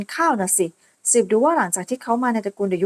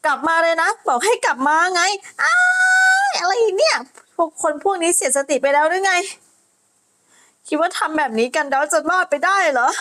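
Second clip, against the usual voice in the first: higher, shouted outrage; furious yell; 5 semitones higher than usual. Acted. Soaked bully yelling at the knight. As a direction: scream after them. Bullies thrown in water, yelling.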